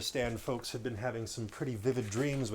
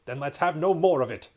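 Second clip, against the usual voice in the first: stern king; 4 semitones higher, 8 dB louder than usual. Acted. King ending the matter.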